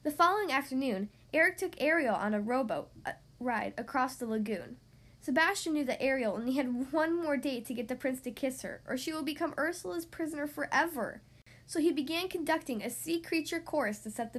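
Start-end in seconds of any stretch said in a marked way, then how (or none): none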